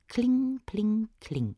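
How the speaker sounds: high, bright-struck anvil clinks; high sing-song clinking; ringing hammer chime. A narrator's reading. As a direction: ring it out like hammer blows.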